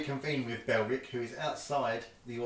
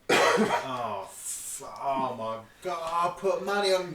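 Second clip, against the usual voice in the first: mock dismay, swelling louder; dismayed gamblers; 5 semitones higher, 6 dB louder, slower than usual. Game master voicing crowd who bet on Asmodeus, dismayed. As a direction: voice the groaning losing bettors. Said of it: "everyone that kind of betted on Asmodeus were like".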